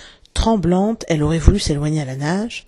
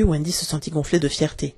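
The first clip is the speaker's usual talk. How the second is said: ordinary delivery, nothing marked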